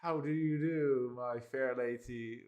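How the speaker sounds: slow, high, hammed-up olde English gentleman; mock Shakespearean. Mocking the AI's stiff courtly wording. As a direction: ham up a courtly old-fashioned greeting. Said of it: "didn't expect it to be Shakespearean".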